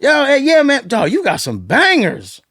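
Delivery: loud, high-pitched enthusiasm; hyped praise. Retold or imitated. Producer phoning an artist about his songs, hyped praise. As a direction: rave like a thrilled producer.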